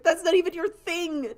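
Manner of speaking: high, loud exasperated retort; mock exasperation. Newcomer arguing with the art kids, mock exasperated. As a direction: push the pitch way up in hammed-up disbelief.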